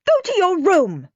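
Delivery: shouting. A narrator's reading. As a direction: shriek the order at him. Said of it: "shouted Mum".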